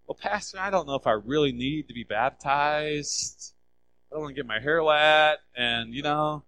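a lightly voiced reluctant member; mock reluctant churchgoer. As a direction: play the doubter making excuses. Acted reluctant congregant's excuse.

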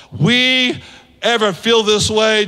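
ordinary delivery

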